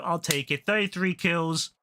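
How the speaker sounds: ordinary delivery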